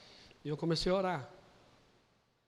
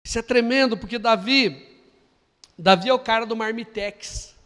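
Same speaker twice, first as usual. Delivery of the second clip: emphatic preaching; 3 semitones higher, 10 dB louder than usual. Acted. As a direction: hammer home the point.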